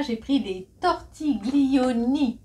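slow, drawn-out fake Italian on "tortiglioni"; mock Italian accent. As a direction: ham up the Italian for "tortiglioni", slowly. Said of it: "Désolée, j'ai pas l'accent italien". (faux accent italien exagéré et lent)

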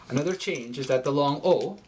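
normal talking voice

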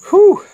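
high sharp exhale from the burn; sharp whew. Real, not acted. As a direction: blow out the heat in one breath. Exhale after hot pepper bite.

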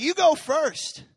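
slow, high, put-on mocking voice; a lightly voiced mocking prophet; mock taunt. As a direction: sneer it slowly like a taunting prophet.

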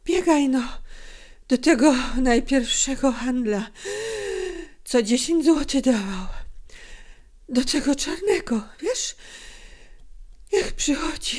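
high, halting, breathless voice; weak mother straining to speak; broken voice. A narrator's reading. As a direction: give orders in gasped fragments. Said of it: "odezwała się matka przerywanym głosem".

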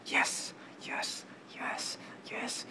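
strange, breathy repeated whisper; creepy whisper. Pretend. Teen goofing for his camera, eerie whisper. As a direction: hiss it like a creepy secret.

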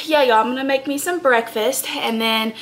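normal talking voice

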